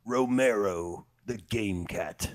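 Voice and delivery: slow, low announcer build-up; movie trailer voice. Acted. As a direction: drag out the name like a trailer. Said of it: "I tried to do my best trailer voice guy impression".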